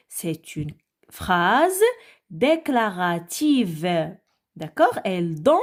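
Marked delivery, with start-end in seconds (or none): 0.1-4.2 very slow, teacher's careful phrase; slow and clear. Shown as a demonstration. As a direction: drag each word out for the pupils.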